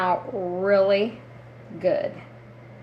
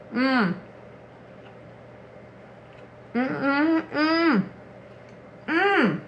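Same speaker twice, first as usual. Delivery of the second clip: savoring mm; 4 semitones higher, 4 dB quieter, slower than usual. Real, not acted. Tasting fresh cinnamon roll.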